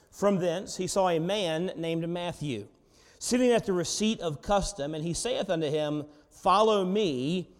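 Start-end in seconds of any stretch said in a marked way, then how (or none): 6.3-7.5 slow drawn-out call. Acted. First reading of the call.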